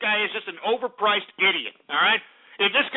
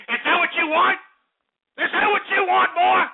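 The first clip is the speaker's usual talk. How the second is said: rising rant; 10 semitones higher, 4 dB louder than usual. Real, not acted. Host ranting louder and higher.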